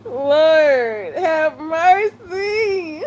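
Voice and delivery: high-pitched, half-crying Lord have mercy; cry-laughing, stretched out slowly; real laughter breaking through the words. Real, not acted. Laughing at the TikTok comments.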